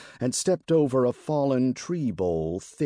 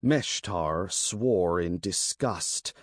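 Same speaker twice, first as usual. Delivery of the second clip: usual speaking voice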